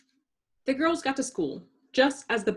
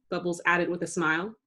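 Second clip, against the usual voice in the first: plain reading voice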